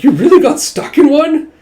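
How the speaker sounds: high, loud, laughing question; laughing disbelief. Acted. Teasing Jaya about the pot, laughing. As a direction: crack up at the friend stuck in a pot.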